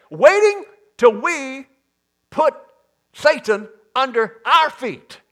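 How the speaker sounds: high, loud, driving preacher's cadence; full-force preaching. Acted. Preacher urging the church to act, loud and rising. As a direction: build it up and hammer it home.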